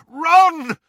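very high, loud shout. A narrator's reading. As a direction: yell to break a friend's trance. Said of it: "he shouted".

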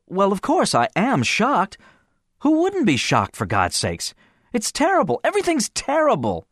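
a voice pushed way up with shock; shocked friend. Acted. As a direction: blurt it out, badly shaken. Gene's shocked outburst to Finny.

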